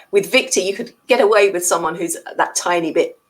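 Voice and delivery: normal talking voice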